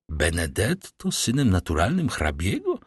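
plain reading voice

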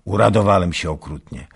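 loud, quick proud boast; triumphant. A narrator's reading. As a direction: crow it out as the nail goes in. Soldier's triumph in his tale.

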